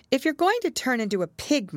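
high-pitched, earnest warning; narrated girl laying down a rule; serious warning. A narrator's reading. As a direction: warn the baby in earnest. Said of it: "said Alice seriously".

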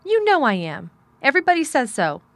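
high, loud, insistent protest; outraged child arguing back; indignant cry. A narrator's reading. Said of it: "he cried".

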